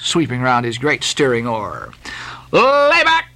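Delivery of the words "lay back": high, drawn-out order; addressing the crew. A narrator's reading. Flask to his crew, calling an order. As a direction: call it out to your rowers. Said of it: "addressed his crew".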